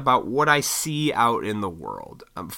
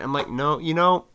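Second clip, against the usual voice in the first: ordinary talk, nothing marked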